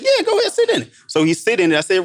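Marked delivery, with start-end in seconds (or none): 0.0-0.9 much higher, keen and inviting; high eager reply. Retold or imitated. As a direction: urge him on in a high, excited voice.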